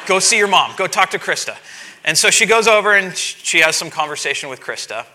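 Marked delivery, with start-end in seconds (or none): 0.1-1.6 louder and higher, sending her off; calling out. Retold or imitated. Dad sending his frustrated daughter to her mother, calling out loud. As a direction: shoo her off to mom.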